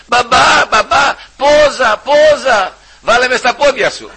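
put-on high, loud, slow little-girl plea; child pleading. Preacher imitating his daughter begging to sleep at his feet. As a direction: beg papa like a small child.

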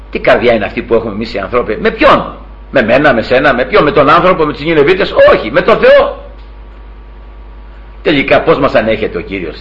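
ordinary talk

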